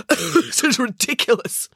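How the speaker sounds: high, slow words after a cough; spluttering. Acted. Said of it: "managed to splutter out".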